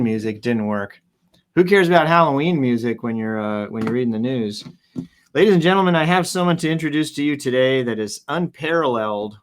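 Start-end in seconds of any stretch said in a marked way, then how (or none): 5.3-9.4 announcer intro. Pretend. Grand guest introduction.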